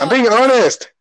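high, loud protest; defensive shout. Real, not acted. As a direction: insist loudly you're honest. Sibling defends herself loudly.

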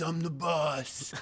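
very slow mock [name] growl; [name] impression. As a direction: stretch it out like a rock star playing the everyman. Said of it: "now he has his".

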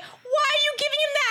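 high-pitched, incredulous demand; alarmed question. Retold or imitated. Questioning friend handing over a taser, alarmed. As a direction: retell it as a high, baffled protest.

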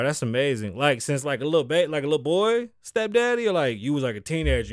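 ordinary talk, nothing marked

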